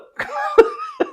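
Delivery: a sudden high-pitched laugh bursting out; high burst of laughter. Real, not acted. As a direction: laugh out loud, high and bright. Laughing at baby clip.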